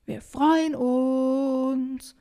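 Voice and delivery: high, very drawn-out shout. Acted. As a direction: call insistently across the water. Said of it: "versucht Emily es noch einmal lauter".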